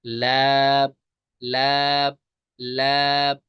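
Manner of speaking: लैब said clean, steady and unnasal; clear lam. Shown as a demonstration. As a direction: repeat लैब without nasal sound. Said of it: "ये पढ़ना है".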